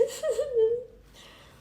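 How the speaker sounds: high, loud burst of laughter. Real, not acted. Reader cracking up at a story, real laugh.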